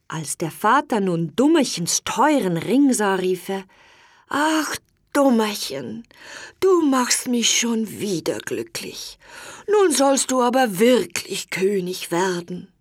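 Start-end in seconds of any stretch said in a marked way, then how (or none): 4.3-12.7 put-on tired old king. A narrator's reading. Old king yields to his sons, lightly voiced and weary. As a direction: give in gently like an old, weak king.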